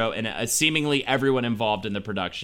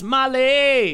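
calling out; 10 semitones higher, 5 dB louder than usual. Real, not acted. Calling out loudly.

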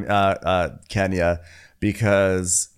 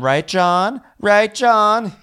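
mock pleading voice; 12 semitones higher, 6 dB louder, much slower than usual. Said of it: "He goes".

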